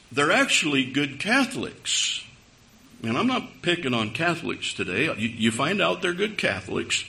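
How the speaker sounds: normal talking voice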